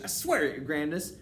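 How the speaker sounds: high, loud desperate oath; panicked underling witch; wretched plea. A narrator's reading. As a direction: swear it frantically to your mistress. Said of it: "cried the wretched witch".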